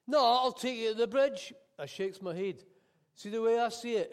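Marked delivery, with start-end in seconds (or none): 0.1-1.5 higher, louder firm refusal; loud insistence. Acted. Cabbie refusing to let the passenger out, loud and firm. 3.1-4.1 raised cabbie voice. Acted. Cabbie argues with passenger.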